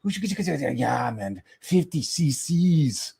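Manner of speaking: high, excited mock-German chatter; imitated German guy. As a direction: mimic a German babbling to his friend, drawing out the English bits. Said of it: "this young guy next to us was talking to his friend".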